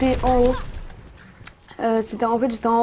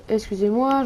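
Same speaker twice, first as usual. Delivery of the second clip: ordinary delivery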